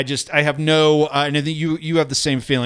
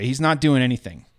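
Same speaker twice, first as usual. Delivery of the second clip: normal talking voice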